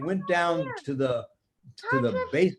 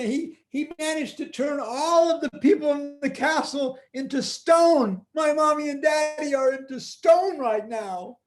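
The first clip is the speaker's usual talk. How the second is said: put-on upset little girl; 10 semitones higher, 3 dB louder than usual. Tina voiced high, upset.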